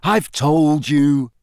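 slow, leaden insistence; dull repetition. A narrator's reading. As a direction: repeat it wearily, drawing out each word. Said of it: "Harry repeated dully".

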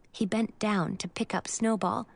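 usual speaking voice

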